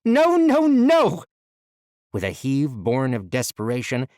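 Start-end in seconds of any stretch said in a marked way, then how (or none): 0.0-1.2 high, loud repeated screams; screaming. Acted. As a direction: scream it over and over. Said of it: "Allison screamed".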